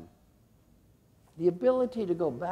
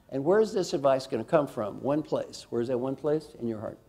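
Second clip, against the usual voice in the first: ordinary talk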